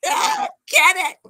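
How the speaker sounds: high, loud, slow squealing plea; panicked cry. Retold or imitated. Wife squealing at her husband to remove the spider. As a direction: beg him to get it off you. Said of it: "having the heebie-jeebies".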